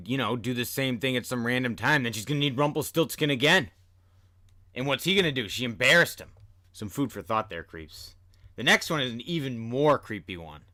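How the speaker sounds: ordinary delivery, nothing marked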